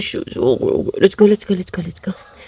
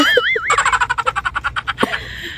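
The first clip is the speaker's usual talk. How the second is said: high burst of laughter; 6 dB quieter than usual. Real, not acted. Laughing at own joke. (mataas na tawa)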